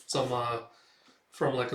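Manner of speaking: ordinary delivery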